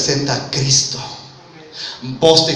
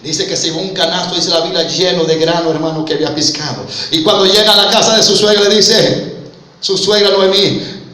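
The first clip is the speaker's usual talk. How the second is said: ordinary talk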